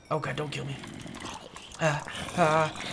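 normal talking voice

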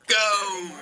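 high, drawn-out "go" call; start signal shout. Real, not acted. Signalling viewers to start the video, high countdown shout.

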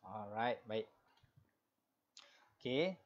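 normal talking voice

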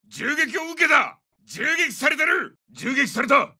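high-pitched cry under fire; alarmed callout. Acted. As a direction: call out urgently that shots are incoming. Soldier reports coming under fire.

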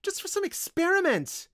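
shrieked high, loud accusation; spitting outrage. A narrator's reading. Windling blaming wizard for the death, furiously. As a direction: hurl it at him, very high and loud. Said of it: "she spat out".

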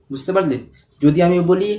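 usual speaking voice

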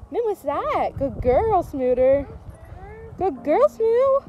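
high, lilting pet talk; praising a dog. Real, not acted. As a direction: coo praise to a beloved dog.

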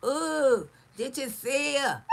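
mock gasp of a gossiping hater. Mocking the haters who gossip about her, in a mock gasping voice. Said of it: "they come out here and start, start more cringes".

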